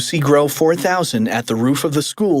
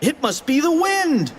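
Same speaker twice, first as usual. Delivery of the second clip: put-on high kid voice; 13 semitones higher than usual. Character replies.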